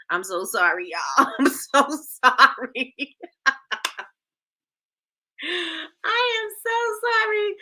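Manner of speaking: apologizing while laughing, slow, rising high at the end; laugh-sob the apology, stretching it out. Real, not acted. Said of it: "I know how my people must feel".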